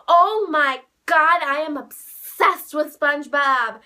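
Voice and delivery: loud, slow, emphatic retold thrill; childlike excitement. Mitch receiving a SpongeBob gift, childlike excitement. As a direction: imitate a kid on Christmas morning, stretching each word. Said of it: "like a little kid on Christmas morning".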